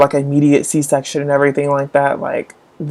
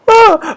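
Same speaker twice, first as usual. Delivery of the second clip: laugh; 4 dB louder than usual. Real, not acted. Laugh after mock plea.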